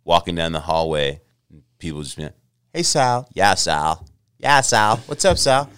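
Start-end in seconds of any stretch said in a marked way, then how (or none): 2.7-5.7 put-on SoCal accent. Kids greeting him in hallway.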